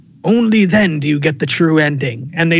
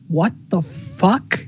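slow mock bafflement; 3 semitones higher, 4 dB quieter, much slower than usual. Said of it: "I'm like".

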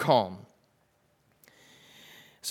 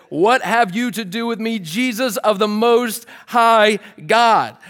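loud, slow demonic cry; 5 semitones higher, 6 dB louder than usual. Retold or imitated. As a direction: cry it out like the possessed man. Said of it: "crying out with a loud voice".

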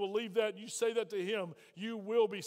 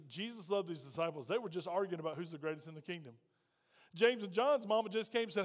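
ordinary talk, nothing marked

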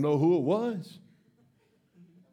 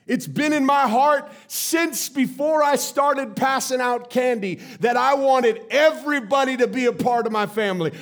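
full-force preaching, louder and higher; 5 semitones higher, 6 dB louder than usual. Acted. Pastor declaring to the kids, preaching at full force. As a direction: build it into a sermon shout. Preaching climax in quoted speech.

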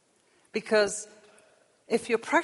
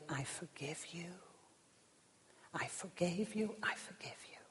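soft whisper of forgiveness; whispered pardon; 14 dB quieter than usual. Acted. God forgiving the sinner at judgment, whispered. God's verdict, voiced in a whisper.

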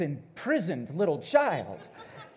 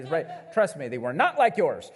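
ordinary talk, nothing marked

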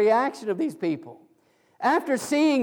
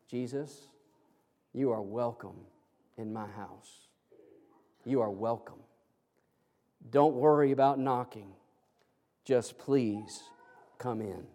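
soft, low, unhurried invitation; gentle welcome; 10 semitones lower, 8 dB quieter than usual. Acted. Householder invites Jesus into his home, gentle welcome. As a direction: welcome an honoured guest in, quietly.

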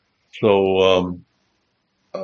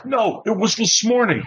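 insistent John; 13 semitones higher than usual. Retold or imitated.

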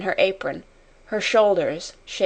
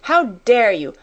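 indignant cry, moderately raised; infuriated; 3 semitones higher, 4 dB louder than usual. A narrator's reading. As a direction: exclaim angrily, punching DARE. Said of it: "she cried".

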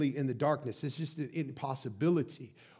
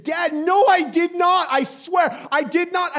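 high, loud frantic denial; protesting daughter imitation; 12 dB louder than usual. Daughter caught by dad, mock indignant denial. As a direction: protest innocence at the top of the voice.

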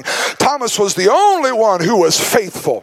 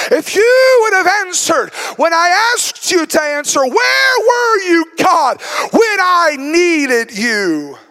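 tearful shout, high, slowing at the end; crying out to God; 7 semitones higher, slower than usual. Acted. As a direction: break down crying while yelling the question. Martha cries out to God.

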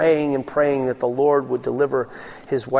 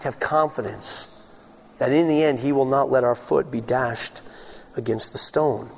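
ordinary talk

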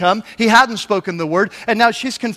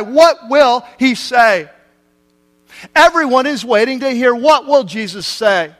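raised, emphatic sermon delivery; full-force preaching; 4 semitones higher, 3 dB louder than usual. Acted. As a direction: build the suspense, pitching the questions high to the crowd. Preacher builds suspense at full force.

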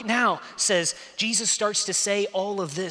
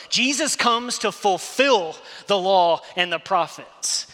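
loud, raised preacher's voice driving each point home; preaching at full force; 6 semitones higher, 6 dB louder than usual. Acted. Preacher urging the congregation to receive grace, loud and driving. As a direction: preach it out, pushing hard at the congregation.

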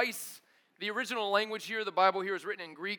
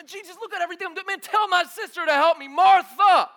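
fast, higher, loud whine; frantic complaint; 7 semitones higher, 12 dB louder than usual. Retold or imitated. Martha complaining frantically to Jesus about her sister. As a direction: rush it like an overworked sister.

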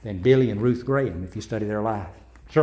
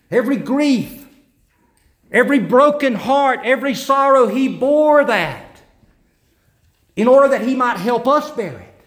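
raised, higher preaching voice proclaiming; fervent preaching; 10 semitones higher, 5 dB louder than usual. Acted. Pastor proclaiming the gospel to the congregation, fervent preaching. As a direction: proclaim it to the whole church.

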